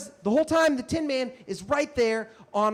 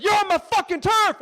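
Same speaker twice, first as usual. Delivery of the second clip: high, loud furious challenge; imitating a raging street performer; enraged yell; 11 semitones higher, 6 dB louder than usual. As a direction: explode at someone in rage. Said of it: "all of the rage he had".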